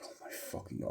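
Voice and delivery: breathless whispered aside. Retold or imitated. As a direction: whisper it in disbelief to yourself. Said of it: "I'm like".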